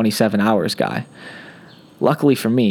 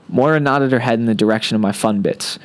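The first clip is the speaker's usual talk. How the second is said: plain reading voice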